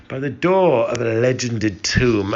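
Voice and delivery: usual speaking voice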